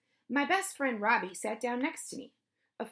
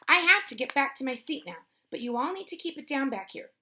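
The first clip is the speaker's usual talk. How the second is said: louder, higher stern telling-off; teacher scolding; 5 semitones higher, 6 dB louder than usual. A narrator's reading. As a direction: lay down the rule firmly, pitched up. Teacher scolds noisy children.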